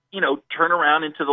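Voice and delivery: normal talking voice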